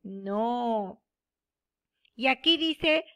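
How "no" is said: ordinary talk, nothing marked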